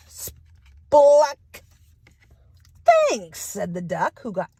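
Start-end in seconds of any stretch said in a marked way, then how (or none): 0.1-1.6 loud, high spluck pop; popping sound. Acted. Duck pops out of muck. 2.8-3.4 very high squeaky thanks; duck's thanks. Acted. Duck thanks helpers.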